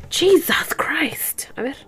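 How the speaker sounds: slow exasperated whisper; whispered exasperation. Real, not acted. Worn-out mom sighing at the baby, whispered. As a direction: breathe it out under your breath.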